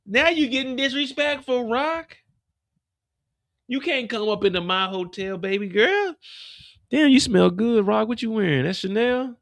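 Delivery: high, squealing Tremont; Tremont, high and flirty. Acted. Tremont flirts with Rock in a high squeal. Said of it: "I'm like".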